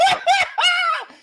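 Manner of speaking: high, loud laughing outburst; cracking up hard, high; burst of laughter. Real, not acted. Cracking up at his co-host's story, loud high laugh. Reacts to "You know what, Ben.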